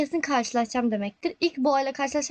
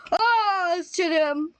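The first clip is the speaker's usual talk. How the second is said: higher, shocked yelp; startled outburst; 5 semitones higher than usual. Real, not acted. Gamer meets the monster, startled high outburst. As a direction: caught off guard by a jump scare.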